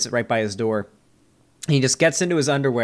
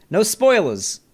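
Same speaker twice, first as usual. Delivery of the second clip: attempted New York accent, pitched up and punchy; 7 semitones higher, slower than usual. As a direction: take a swing at a New York accent and miss a bit. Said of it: "I try to do a New York accent".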